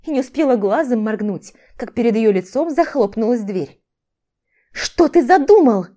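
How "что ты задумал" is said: high, loud, drawn-out question; alarmed, angry demand; attacking demand. A narrator's reading. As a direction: pounce on him with the question. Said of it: "Набросилась на него".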